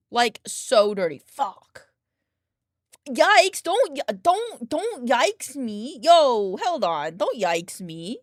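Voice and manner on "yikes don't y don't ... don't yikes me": high, loud, drawn-out don't yikes me; stretched mock-indignant protest; mock offended. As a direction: playfully scold the cat for judging you. Playful scolding cat, mock offended.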